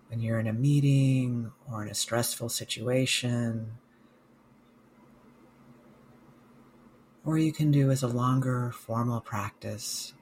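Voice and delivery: slow, calm meditation guide with long pauses; guided meditation. Real, not acted. Mindfulness coach guiding listeners through a body scan, guided meditation. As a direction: guide listeners gently, leaving space after each phrase.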